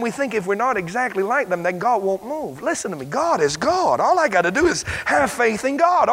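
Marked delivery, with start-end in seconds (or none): none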